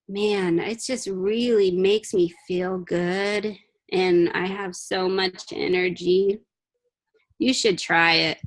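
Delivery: low, soft, slow mock listless voice; flat mock-unenthused sales pitch; bored monotone. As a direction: drag it out like Eeyore. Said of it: "are you like".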